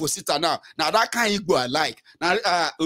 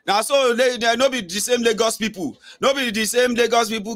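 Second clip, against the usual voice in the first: lightly mimicked complainers, higher pitch; mock objectors; 7 semitones higher than usual. Host mimicking followers who defend Lagos people, mock objecting. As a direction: imitate indignant objectors in a raised pitch. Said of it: "some of you who have been like".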